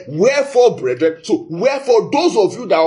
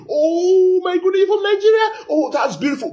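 overjoyed squeal; 13 semitones higher than usual. Retold or imitated. Nigerian abroad meets a countryman, high tearful squeal of joy. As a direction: gush like meeting family abroad.

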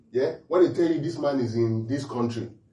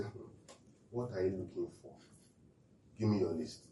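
indulgent soft coax; 7 semitones lower, 13 dB quieter than usual. Retold or imitated. God voiced as indulgent parent.

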